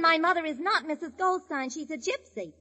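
normal talking voice

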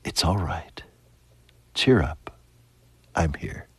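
hushed, low and soft, with long pauses; tender whisper. Retold or imitated. Jesus comforting a troubled believer, tender whisper. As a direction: breathe each comfort gently into the ear. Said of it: "let him whisper to you".

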